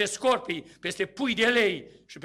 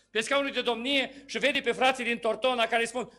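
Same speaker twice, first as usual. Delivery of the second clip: usual speaking voice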